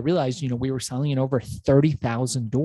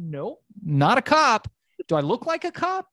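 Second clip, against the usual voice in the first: incredulous denial; 10 semitones higher than usual. Retold or imitated. Denying being a cop.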